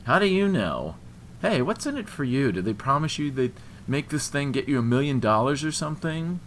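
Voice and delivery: loud, high-pitched suspicion; accusing challenge. A narrator's reading. Man questioning what she gains, accusing and loud. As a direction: fire the questions at her loudly.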